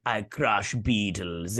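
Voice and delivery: put-on slow, drawn-out Russian villain accent. Villain boasting about taking out heroes, slow Russian accent. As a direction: say it slowly as a Russian heavy. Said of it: "I gave him a Russian accent".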